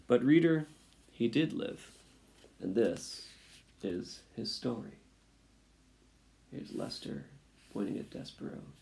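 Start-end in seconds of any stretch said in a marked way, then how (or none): none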